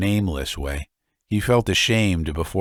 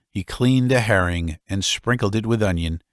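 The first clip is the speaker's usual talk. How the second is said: plain reading voice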